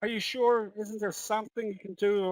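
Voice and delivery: high, softened plea of a pet owner; imitated desperate customer in a joke; mock pleading. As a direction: beg for another option, hammed-up and high-pitched.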